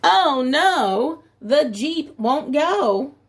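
normal talking voice

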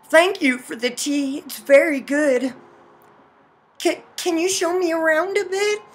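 lightly voiced, courteous heroine; polite heroine. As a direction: play the grateful guest with a hesitant question.

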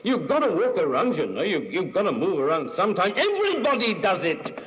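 heated, high-pitched insistence; sudden passion. A narrator's reading. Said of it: "he said in a sudden, dull passion".

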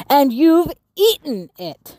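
slow, hammed-up grumpy accusation; a touch of a monster grumble; cross accusation. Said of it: "shouted Marvin".

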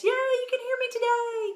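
high, bright burst of relief; greeting viewers, delighted the audio works, excited; excited cheer. Real, not acted. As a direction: cheer that the sound works at last.

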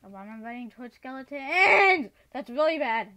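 loud alarmed cry; startled, frightened shout; panicked yell. Real, not acted. Runs toward skeletons.

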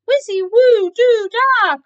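high, very loud incantation; crying out a spell. A narrator's reading. Keith casting for impatient cats, crying out. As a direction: shout out nonsense magic words grandly. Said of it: "cried Keith".

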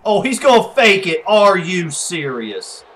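high, loud incredulous protest; loud disbelief. Real, not acted. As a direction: cry out at an unexpected trick play. Disbelief at a fake kick.

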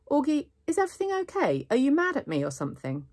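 high-pitched, eager check-in on a friend; concerned questioning. A narrator's reading. Girl checking whether her friend is mad at her, concerned. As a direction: ask with rising, high-voiced concern.

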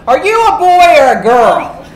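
loud, high mock yell of a kid's question; imitated scream. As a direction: imitate a child hollering at the top of her lungs. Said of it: "at the top of her lungs, she screams".

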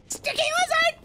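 very high-pitched excited cry; frantic high call; excited squeal. Real, not acted. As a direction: squeal it like a thrilling find. Chasing lizard in game.

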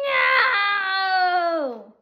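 high, loud cry of defeat; shouted no. Acted. Alien losing to the unicorn, shouting no. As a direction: yell it as the beaten alien.

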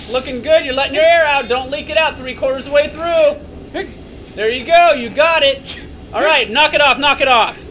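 loud, high urgent commands; shouting coach. Real, not acted. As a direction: drive him hard over the noise. Coach shouting during g-force run.

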